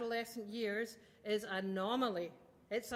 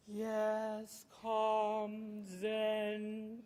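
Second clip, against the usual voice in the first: mock zen calm; 2 semitones lower, much slower than usual. Shown as a demonstration. Parent showing how she calms herself, mock zen. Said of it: "I have to really work on".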